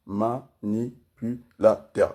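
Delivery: very slow, five separate syllables. Shown as a demonstration. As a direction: spell out every syllable so it sinks in. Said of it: "Je suis à cinq syllabes".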